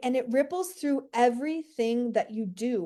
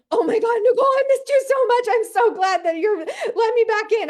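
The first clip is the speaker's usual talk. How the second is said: much higher, much louder gushing cry; screaming excitedly; 11 semitones higher, 8 dB louder than usual. Pretend. Pretend client screaming with relief back to Nicole. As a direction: squeal like a client desperate to come back. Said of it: "come screaming back to me saying".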